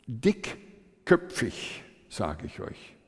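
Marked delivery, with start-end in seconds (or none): 0.1-1.8 "dickköpfig" drawn out very slowly, syllable by syllable; "dickköpfig" broken up for emphasis; emphatic slow stress. Real, not acted. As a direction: stretch "dickköpfig" into separate weighty pieces. Stressing how stubborn the son was.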